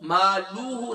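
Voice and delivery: "مالوہ" with lengthened luu; slow "مالوہ" with an extra waw sound; overstretched lam. Shown as a demonstration. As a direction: cite the mistake "مالوہ", lam dragged out. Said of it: "نہیں ہے".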